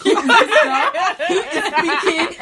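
high, loud outburst through laughter; mock disbelief. Retelling the stares when her accent switched, mock disbelief. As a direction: shout it in laughing, exaggerated bafflement. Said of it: "looked at me like".

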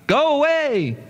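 high jeering cry from the crowd; shouted from the crowd. A narrator's reading. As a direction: call it out from the back of the hall. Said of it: "Someone shouted loudly".

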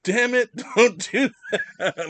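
high, loud, slow scolding; laughing mock outrage; freaked-out grandma. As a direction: imitate a startled grandmother telling off, breaking into laughter. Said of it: "she got freaked out".

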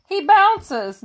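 put-on a child's high goat voice; nickering goat. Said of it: "nickered the goat".

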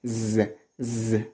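buzzing "Z" sound; voiced S sound. Shown as a demonstration. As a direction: hold "Z" as a voiced zzz. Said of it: "S have this sound".

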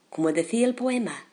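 ordinary delivery

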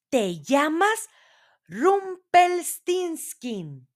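high, slow, drawn-out reveal; queen's answer. A narrator's reading. Queen revealing the dwarf's name, high drawn-out answer. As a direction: draw the name out high for suspense. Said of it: "ésta le contestó".

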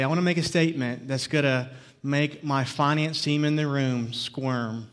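ordinary delivery

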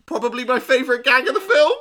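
high, loud and fast gushing; excited. Real, not acted. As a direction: burst out with delight. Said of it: "because I just fucking".

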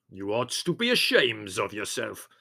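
scolding in a put-on character voice; disgusted. A narrator's reading. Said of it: "said Spiro in disgust".

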